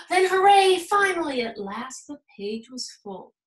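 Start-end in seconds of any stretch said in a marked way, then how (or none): 0.1-1.4 high, loud hooray; relieved cheer. A narrator's reading. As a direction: burst out in relief. Relief after escaping.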